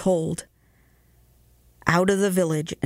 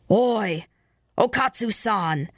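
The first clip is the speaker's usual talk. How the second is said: menacing call; 4 semitones higher, slower than usual. A narrator's reading. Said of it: "more of menace in its tone".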